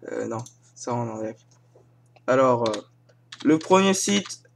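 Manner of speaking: ordinary talk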